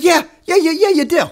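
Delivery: high, loud sing-song mock agreement; mocking yes-yes. Mocking the YouTuber who skips Waco, high taunting.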